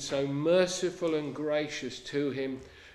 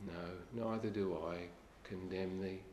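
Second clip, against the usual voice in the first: much softer, lower, gentle reply; soft-spoken mercy; 5 semitones lower, 9 dB quieter than usual. Acted. The Lord quietly answering the woman caught in adultery, soft and low. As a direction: let it fall soft and low, no judgement.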